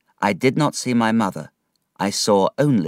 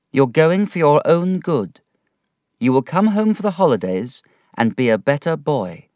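a lightly voiced mother, louder, sending him off; mother's farewell; 6 semitones higher, 5 dB louder than usual. A mother sending her son off to school, lightly voiced and louder. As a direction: bid him goodbye, hiding the hurt.

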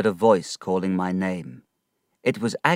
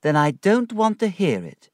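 high, louder petulant refusal; pouting young woman; 11 semitones higher, 5 dB louder than usual. A narrator's reading. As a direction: refuse it like a spoilt girl. Dora refuses bad news.

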